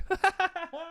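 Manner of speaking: high giggle; laughing. Real, not acted. Man cracking up at his own rant, high giggle. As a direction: burst of high-pitched laughter.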